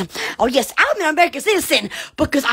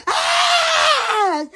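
shriek; 10 semitones higher than usual. Real, not acted. Excited shrieking at electricity.

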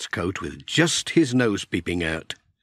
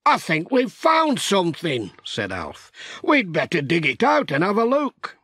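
raised character voice; Alf's voice; 6 semitones higher than usual. Acted. Narrator voicing Alf finding the sheep. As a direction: lift the voice for Alf's line. Character dialogue in read story.

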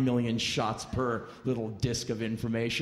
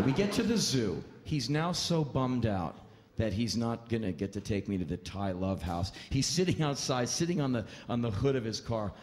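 ordinary talk, nothing marked

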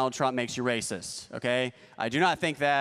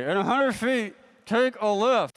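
higher robotic navigation voice; GPS voice; 4 semitones higher than usual. Acted. Trunk GPS giving directions, mock robotic voice. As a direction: read it out like the sat-nav. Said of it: "pretend I kidnap somebody helpful".

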